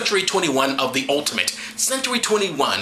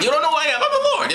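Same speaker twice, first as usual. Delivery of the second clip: mocking God; 12 semitones higher, faster than usual. Mocking God's self-introduction.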